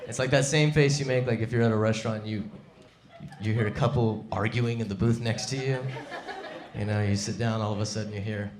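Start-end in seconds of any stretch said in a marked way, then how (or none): none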